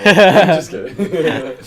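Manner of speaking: giggling through the words; laughing. Real, not acted. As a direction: laugh it out loud. Laughing after joke.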